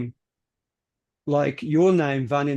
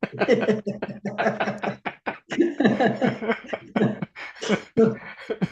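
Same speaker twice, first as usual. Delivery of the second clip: laughing; 7 semitones higher than usual. Real, not acted. Laughs at own example.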